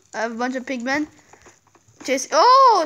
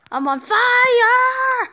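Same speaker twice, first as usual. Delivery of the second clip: high, loud, slow panicked cry; panicked shout; 11 semitones higher, 5 dB louder, much slower than usual. Real, not acted. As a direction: yell in real alarm. Catching fire in game.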